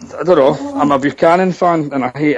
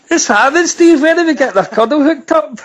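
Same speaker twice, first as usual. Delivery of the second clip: boisterous greeting; 10 semitones higher than usual. Real, not acted. [name] greeting caller Steve, high and boisterous.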